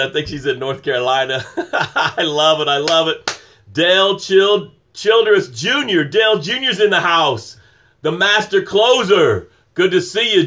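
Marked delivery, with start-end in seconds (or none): 1.5-3.4 slow, amused laugh into the words; laughing fondly at the comments. Real, not acted. Laughing at viewers' gratitudes. 3.7-10.5 ringside-announcer welcome for the master closer; hype shout-out. Real, not acted. Hyping up a viewer's arrival.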